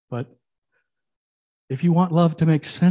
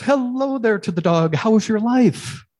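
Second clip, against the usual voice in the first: higher, louder cheerful greeting; a bright, welcoming host at the throne; friendly God voice; 8 semitones higher, 6 dB louder than usual. Acted. God greets dog in heaven.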